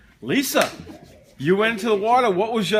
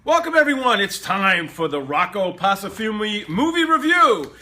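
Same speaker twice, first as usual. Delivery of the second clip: announcer voice; 3 dB louder than usual. Acted. Opens the movie review segment.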